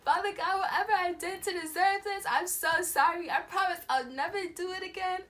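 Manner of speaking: high, loud pleading prayer; desperate praying. Retold or imitated. Dreamer praying to Father God for forgiveness, desperate. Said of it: "Like, I started praying".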